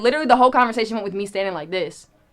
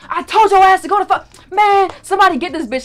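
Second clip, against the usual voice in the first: much higher and louder, slowed rant; mock furious yell; 12 semitones higher, 11 dB louder than usual. Teller voicing the rapper finding her in the lobby, furious mock shout. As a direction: explode at someone who won't leave. Angry man voiced in story.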